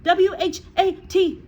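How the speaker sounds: high, slow squeal; high frightened squeal. A narrator's reading. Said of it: "he squealed".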